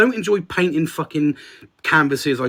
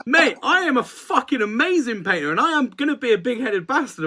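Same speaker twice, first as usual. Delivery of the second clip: laughing boast; 7 semitones higher than usual. Real, not acted.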